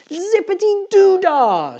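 very high, long whooped cheer; piercingly high, stretched celebratory yell; jubilant shout. A narrator's reading. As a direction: holler it out in pure delight. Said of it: "shouted Missus Drizzle".

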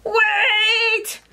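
high, long drawn-out call; yelling rabbits. Acted. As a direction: yell after someone in a little high voice. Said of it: "yelled some rabbits".

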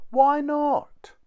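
very high, loud question; little boy objecting loudly; indignant protest. A narrator's reading. Tom protests mother's refusal.

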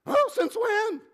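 very high, loud mock surprise. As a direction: squeak it out like a shocked churchgoer. Churchgoer acts surprised.

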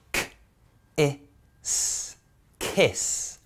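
K, I, S, then kiss said whole; sounding out. Shown as a demonstration. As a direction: sound out each letter then blend into kiss. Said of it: "blended in words for you".

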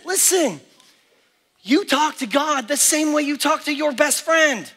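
normal talking voice

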